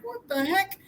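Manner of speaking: high-pitched mock bewilderment; baffled. Trapped man left behind by the priest, baffled. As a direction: squeak it out, baffled. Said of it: "and the guy's like".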